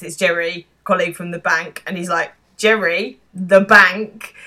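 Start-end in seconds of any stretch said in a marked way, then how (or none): 2.6-4.3 louder incredulous echo; disbelieving. Retold or imitated. Jez echoing Mark's introduction of his colleague, disbelieving. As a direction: repeat it back as a loud, disbelieving question. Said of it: "the disbelief in Jeremy's demeanor".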